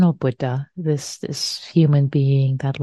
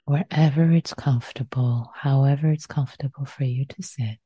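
guided meditation. Acted. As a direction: guide them gently into stillness.

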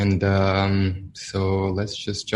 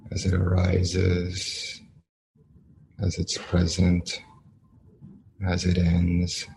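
guided meditation. Acted. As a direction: ease the listeners into stillness, one phrase at a time.

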